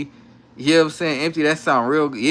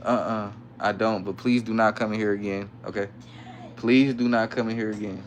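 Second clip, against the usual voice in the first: softer, turned away from the mic; 7 semitones lower, 8 dB quieter than usual. Real, not acted. Aside to kids off the live.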